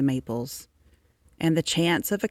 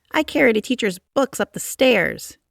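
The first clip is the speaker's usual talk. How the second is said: put-on proud boy voice; 7 semitones higher, 5 dB louder than usual. A narrator's reading. Mean boy boasts in class.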